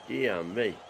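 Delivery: ordinary talk, nothing marked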